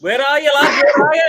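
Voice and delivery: high, loud call across the room; hollering for the other Scouser. Real, not acted. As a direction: shout out to find the lad. Calling out to the Scouser.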